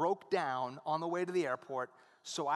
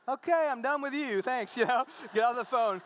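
mock exasperation; 7 semitones higher, 7 dB louder than usual. Retort to friend on phone.

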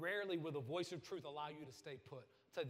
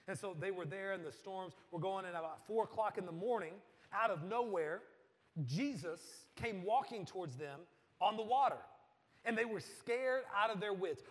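loud, rising preacher storytelling; big building sermon voice; preaching at full force; 5 semitones higher, 11 dB louder than usual. Acted. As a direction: drive the storm story home to the crowd. Preaching the storm scene.

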